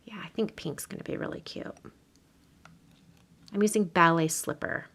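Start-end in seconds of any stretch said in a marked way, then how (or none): 0.0-1.7 soft whisper to herself; hushed crafting whisper; whispered aside. Real, not acted. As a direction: breathe it out like a private thought. Quiet aside while crafting.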